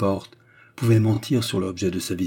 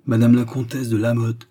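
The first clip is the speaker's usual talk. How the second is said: raised voice, calling her by name; loud calling out; 3 semitones higher, 7 dB louder than usual. A narrator's reading. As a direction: announce the title aloud, formally. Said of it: "l'appela hautement et à dix reprises".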